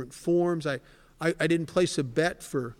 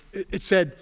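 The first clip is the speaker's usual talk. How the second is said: ordinary talk, nothing marked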